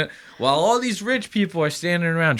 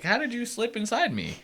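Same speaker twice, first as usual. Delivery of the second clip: higher, softer startled mock question; mock bewilderment; 7 semitones higher, 8 dB quieter than usual. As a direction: ham up the girls' confusion. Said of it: "They're like".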